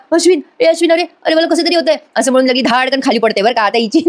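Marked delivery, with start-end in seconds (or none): 0.1-2.0 higher, hammed-up faint cry; imitating a staged swoon, voice raised; mock fainting spell. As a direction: fake a dizzy spell, pitched up. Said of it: "चक्कर येते बरं का तिला".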